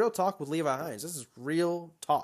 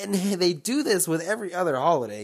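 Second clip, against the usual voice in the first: normal talking voice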